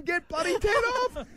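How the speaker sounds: high, urgent panicked yell; frantic cry to kill the film; panicked order. Retold or imitated. Dad retelling his panic at the TV, high and urgent. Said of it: "I'm going".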